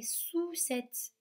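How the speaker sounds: "sous-cette" with a long oo sound in the first syllable; wrong OU vowel. Shown as a demonstration. Teacher shows children what ou would give, "sous-cette" with long oo. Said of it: "Si c'était le ou".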